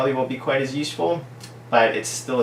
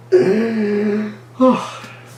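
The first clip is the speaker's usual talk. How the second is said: hammed-up sigh; 10 semitones higher, 6 dB louder than usual. Overwhelmed by the card.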